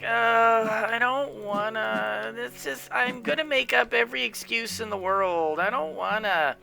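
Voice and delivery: reluctant whine. Retold or imitated. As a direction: grumble it to yourself like a kid dodging chores. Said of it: "in your mind are gonna be like".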